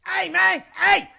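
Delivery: high, loud yell of an enraged man; furious mock shout. Angry man runs at him in the casino, shouting furiously. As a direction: imitate a livid stranger charging over. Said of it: "foamingly pissed".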